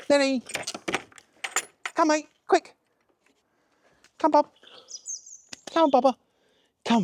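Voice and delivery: high, bright call to the dog. Real, not acted. Calling the dog to come.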